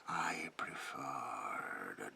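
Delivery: very slow, drawn-out eerie whisper; sinister slow whisper with long hissing endings; ominous confiding. Acted. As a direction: stretch each word, lingering on "darkness". Confides bond with darkness.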